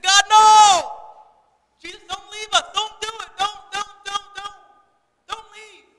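high, wailing mock plea from the disciples; pleading like niece. Disciples begging Jesus not to leave, high childlike pleading. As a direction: beg in a high child's cry, loud at first. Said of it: "That was my niece yesterday".

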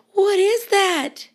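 mock scared. Frightened mouse asking, high and slow. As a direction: ask it wide-eyed and scared.

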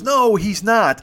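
stretched-out imitation of her objection; imitated insistent protest. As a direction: play the woman objecting, slow and high. Woman contradicting her drunk companion.

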